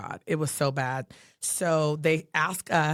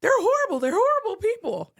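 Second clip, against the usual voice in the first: much higher, louder outraged cry; 3 dB louder than usual. Retold or imitated. Friend scolds caseworker for inviting grandparents, outraged high cry. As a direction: let the disgust break out high and loud. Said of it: "she tells C P S".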